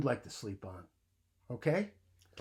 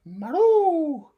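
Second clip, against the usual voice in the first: high loud drawn-out cat yowl; hissing cat; 15 dB louder than usual. Acted. The woken cat yowling, high and loud. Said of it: "who hissed".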